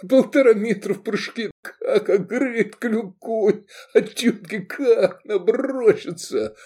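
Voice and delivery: high, slow voice choked with laughter; laughing retelling. A narrator's reading. Man retelling the women's attack, helpless with laughter. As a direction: barely get the story out for laughing.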